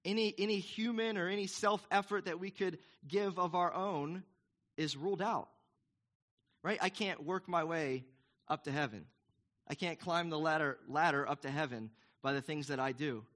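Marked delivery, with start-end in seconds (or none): none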